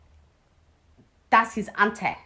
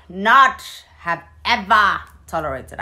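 louder, punching every word; emphatic outburst; 2 semitones higher, 10 dB louder than usual. Real, not acted. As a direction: hit not have ever hard. Stressing what she put up with.

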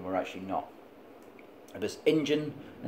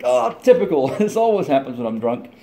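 mock resigned moan; 6 semitones higher, 9 dB louder than usual. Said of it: "Like, like".

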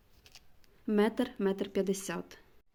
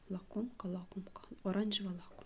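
whispering to herself; 9 dB quieter, faster than usual. Real, not acted. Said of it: "reading unfamiliar name".

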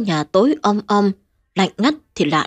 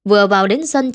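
usual speaking voice

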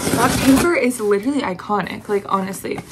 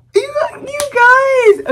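much higher gasp then shrieked words; excited shriek; 7 dB louder, much faster than usual. Real, not acted. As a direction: shriek at the friends in delight. Another surprise gift.

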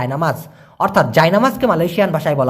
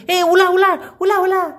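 high, startled call of উলা উলা; imitated warning shout, much higher; alarmed cry at a snake; 11 semitones higher than usual. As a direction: mimic someone spotting a snake and yelling. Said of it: "কেউ একজন সাপ দেখছে বলতে".

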